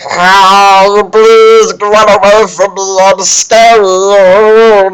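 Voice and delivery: high, very loud drawn-out bellow; mock battle cry, stretched and roaring; bellowing man-at-arms. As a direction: bellow each word long, hammed-up. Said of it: "his man-at-arms are like".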